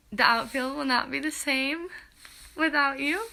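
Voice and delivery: real tears, pushing the words out; tearful. Real, not acted. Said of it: "don't mind my little tears".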